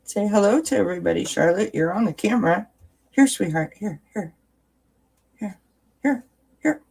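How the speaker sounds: high, coaxing pet voice. Real, not acted. Mommy offering Charlotte water, high coaxing baby talk. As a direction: lure her in sweetly, voice up high.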